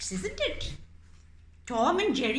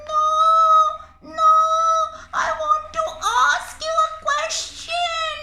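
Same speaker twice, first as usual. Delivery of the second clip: much higher, loud squeaky begging; squeaky pleading mouse; 10 dB louder than usual. Acted. Mouse puppet begging the cat not to eat it, squeaky. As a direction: squeak for your life.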